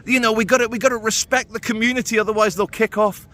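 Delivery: mock Yvette Cooper. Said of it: "talking about the poor rioters".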